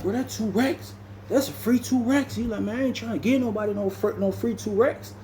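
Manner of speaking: lightly voiced indignation; indignant refusal. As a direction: push the pitch up, refusing outright. Sid refusing to pay.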